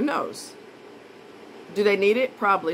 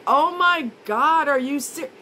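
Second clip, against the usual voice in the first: drawn-out, high Oh my God; slow, raised shocked exclamation; shocked disbelief; 5 semitones higher, 4 dB louder, slower than usual. Retold or imitated. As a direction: gasp it out in disbelief. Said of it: "And I'm like".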